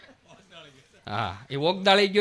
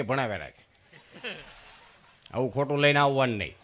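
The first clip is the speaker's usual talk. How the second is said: dismissive father; 7 semitones lower, 3 dB quieter than usual. Retold or imitated.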